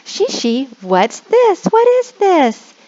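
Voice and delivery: put-on high, thrilled questions to a child; excited teacher. Shown as a demonstration. Teacher showing how to greet a child with excitement. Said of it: "and you can say".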